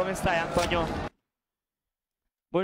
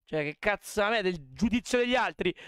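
ordinary talk

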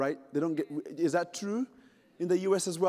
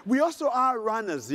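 usual speaking voice

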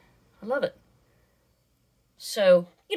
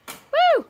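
loud, very high "woo"; high whoop; 8 dB louder than usual. Real, not acted. Playing along with her busy parrot, high whoop. Excited cry beside parrot.

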